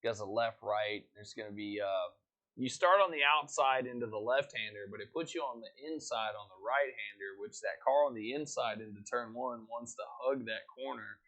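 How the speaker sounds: a hint of race caster play-by-play; lightly voiced grid rundown for the stream; race commentary.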